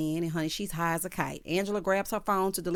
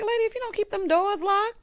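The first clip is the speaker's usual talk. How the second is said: shocked scolding; 13 semitones higher than usual. Pretend. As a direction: squeal it at the careless lady. Reacting to a shocking scene.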